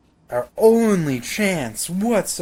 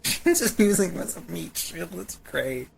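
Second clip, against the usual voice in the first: ordinary delivery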